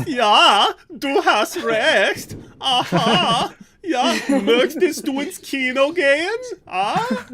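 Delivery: high, loud, exaggerated German accent, dragged out; over-the-top mock German accent from school German class; ridiculous German accent. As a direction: ham up a fake German accent, laughing through it. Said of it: "I would always speak with a ridiculous accent".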